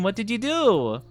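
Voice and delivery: usual speaking voice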